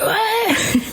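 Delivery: a high cheering laugh; a crowd of classmates cheering, a high whoop; high delighted whoop. Retold or imitated.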